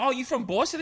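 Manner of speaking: high-pitched imitation of a girl; curious girls. Girls asking a New Yorker about his accent, imitated. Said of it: "so many girls be like".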